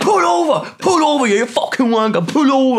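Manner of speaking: high, loud road-rage yell; imitated angry shouting. Storyteller playing the driver demanding he stop, furious shouting. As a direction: bellow orders as the furious driver. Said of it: "He looks that angry".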